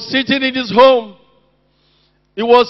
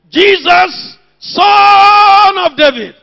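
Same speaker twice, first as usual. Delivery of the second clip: slow, loud, high cry of Jesus Son of David; retold shout; 6 semitones higher, 6 dB louder, much slower than usual. Blind Bartimaeus crying out over the crowd, loud and drawn out. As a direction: call out like a blind beggar in the crowd. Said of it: "he was shouting".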